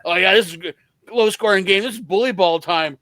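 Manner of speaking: quoted halftime interview. Retold or imitated. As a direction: voice the player's upbeat halftime answer. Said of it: "and he's like".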